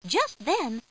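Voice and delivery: ordinary delivery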